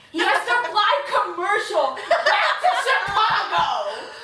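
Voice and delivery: can't get through it without cracking up; laughing. Real, not acted. Telling her friend the plot, breaking into laughter.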